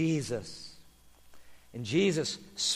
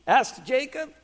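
ordinary delivery, nothing marked

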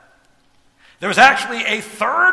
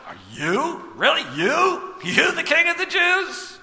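incredulous and sneering, building higher; derisive disbelief; 7 semitones higher than usual. Retold or imitated. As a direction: scoff at the beaten prisoner. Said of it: "a little derisive".

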